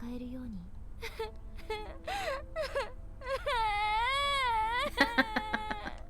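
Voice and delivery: put-on an eerie mix of laughter and crying from a ghost girl. Girl hugs, eerie laugh and cry.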